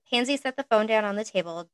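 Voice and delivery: ordinary delivery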